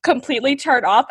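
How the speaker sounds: usual speaking voice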